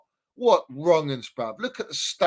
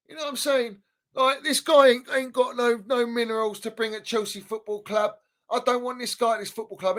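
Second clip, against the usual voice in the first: normal talking voice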